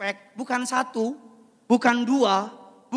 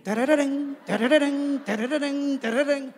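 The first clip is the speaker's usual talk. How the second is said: higher, louder tune hum; sung fanfare imitation; 5 semitones higher, 5 dB louder than usual. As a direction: hum a trumpet flourish. Imitating music. (tiruan suara musik)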